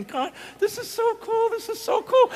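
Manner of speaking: high-pitched giddy mock joy; giddy thrill. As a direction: gush it like a thrilled kid mid-run. Imitating the runner's giddy joy.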